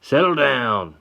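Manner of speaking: high, loud command; marshal's yell. Acted. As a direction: bark order at the men. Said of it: "yells the marshal".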